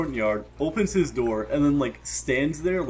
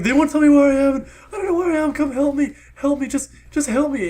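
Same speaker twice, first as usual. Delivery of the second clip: high, loud mock plea for help; imitated screaming; 12 semitones higher, 8 dB louder than usual. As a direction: mimic a woman screaming into a phone. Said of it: "screaming, like".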